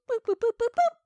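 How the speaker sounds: high imitated keypad boops; officer punching in the code, playful boops; keypad beeps. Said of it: "DeCurtis goes".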